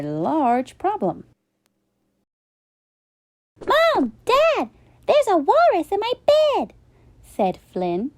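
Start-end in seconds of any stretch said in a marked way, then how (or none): 3.7-6.7 put-on high, fast excited child voice; young boy urgently calling his parents. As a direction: Flynn bursting in with news, high and quick. Child calling parents about walrus.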